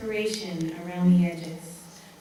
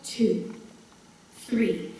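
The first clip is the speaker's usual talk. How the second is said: measured count with a pause after each number; stern counting; 3 semitones lower, 4 dB quieter, much slower than usual. A narrator's reading. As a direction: count it out sternly, one word at a time. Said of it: "her husband sternly counting".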